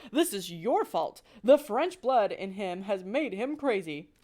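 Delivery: angry father mouse accusing; blaming father. Acted. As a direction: lay the blame on her. Husband blames wife.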